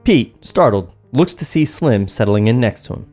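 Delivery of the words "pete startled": ordinary talk, nothing marked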